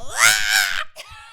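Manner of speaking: very high Toad-style shriek; Toad impression screech. Capping the preacher bit, Toad impression screech. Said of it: "That was my Toad impression".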